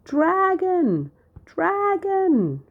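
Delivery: very high, loud alarm call; shout. A narrator's reading. Shepherd girl fake-alarming the town again, shouting. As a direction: yell it from the hilltop. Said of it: "there came another shout from the hill".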